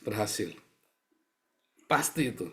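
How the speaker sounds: ordinary talk, nothing marked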